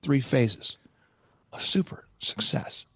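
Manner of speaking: very slow, hushed theatrical imitation; hammed-up mentor savoring each phrase; theatrical Michael Levine. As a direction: draw it out, sinking into a dramatic whisper. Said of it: "by the way, very theatrical".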